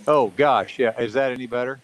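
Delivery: ordinary delivery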